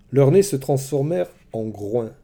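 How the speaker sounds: ordinary delivery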